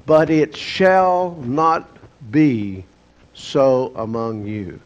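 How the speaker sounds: slow, with weighty pauses between words; emphatic command. Acted. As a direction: spell out the prohibition word by word, "shall not ... be". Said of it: "Jesus is very emphatic to his disciples".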